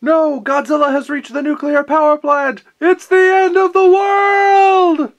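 loud, high, hammed-up alarm; over-the-top disaster yell; panicked shout. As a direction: scream the end of the world.

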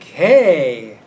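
loud, drawn-out hey, pitch swooping up; imitated hearty shout of hello; called-out greeting. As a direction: call it out like hailing a friend. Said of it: "he's like".

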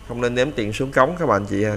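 ordinary talk, nothing marked

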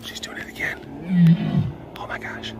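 hushed whisper. Real, not acted. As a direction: whisper so she won't hear. Whispered aside.

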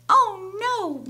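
high, loud cry of dismay; mouse's yell. A narrator's reading. Mister Mouse sees the broken tusk, high dismayed yell. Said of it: "Mister Mouse yelled".

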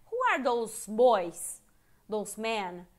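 teacher voicing the girls' question slowly; slow English demo. Shown as a demonstration. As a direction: model the question word by word. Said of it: "aqueles caras".

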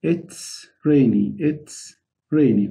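it's rainy stretched out for repeating; slow drill; slow model phrase. Shown as a demonstration. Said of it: "بقول الجو ممطر".

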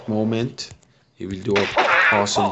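usual speaking voice

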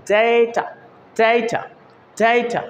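ডেটা as "daay-ta", first vowel held long; lengthened A. Shown as a demonstration. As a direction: draw out the "ay" in ডেটা. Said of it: "একটু লম্বা করে বলতে হয়". (ডেটা-র এ টেনে লম্বা করে)

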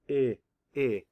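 "I, I" said as a short "ih", repeated; short I sound. Shown as a demonstration. Teacher showing what the schwa says, short I sound. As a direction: isolate the vowel as a short "ih". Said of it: "the short I sound".

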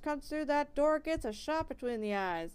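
put-on loud threats in a country accent from behind a door. Villager yelling through the mill door, country accent. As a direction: bark it out like a riled country man through a door. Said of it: "gave that guy a country accent".

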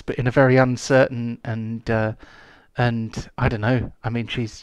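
ordinary talk

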